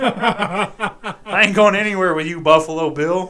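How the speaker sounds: slow, high, very loud comic refusal; loud mock refusal. Turning down a creepy suitor in a story, loud mock outrage. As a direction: belt out an outraged no through laughter.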